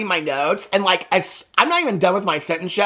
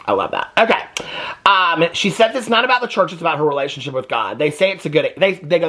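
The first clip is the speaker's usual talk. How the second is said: ordinary talk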